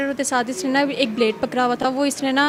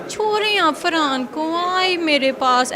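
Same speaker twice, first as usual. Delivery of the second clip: slow, drawn-out mimicry of a lovestruck man; imitating blackmailer's pleading; 3 semitones higher, slower than usual. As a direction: mimic his wooing, stretching each word. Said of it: "ऐसे बात वो कर". (आशिक की नकल में खींच-खींचकर बोलना)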